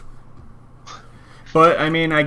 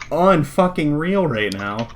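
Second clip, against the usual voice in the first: ordinary talk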